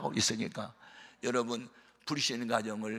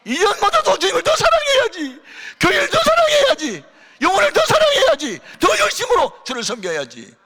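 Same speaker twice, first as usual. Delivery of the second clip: much higher and louder, shouted sermon cries; fiery preaching; 15 dB louder than usual. Acted. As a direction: preach it at the top of your voice. Pastor preaching at full force.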